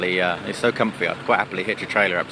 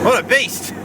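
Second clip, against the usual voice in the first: higher, louder, delighted exclaim; joyful exclaim; excited; 12 semitones higher, 5 dB louder, slower than usual. Real, not acted. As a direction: marvel at the lorry. Thrilled driving the lorry.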